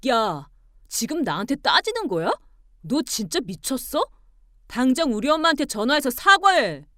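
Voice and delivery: scolding, building to a loud order; confront, then order loudly; angry demand. Acted. Husband ordering wife to apologise, angry.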